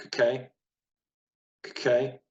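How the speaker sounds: "kake" as k'KAY, first vowel hardly there; barely pronounced shva. Shown as a demonstration. Teacher modelling the shva for learners, hardly pronounced. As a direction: say "kake" as a quick k, then a clear "kay". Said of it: "it's hardly pronounced".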